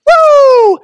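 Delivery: a loud high whoop of glee; gleeful devil whoop. Retold or imitated. Preacher voices the devil whooping loud and high over quarrelling Christians. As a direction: whoop like a devil delighted by the fighting. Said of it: "like the devil saying".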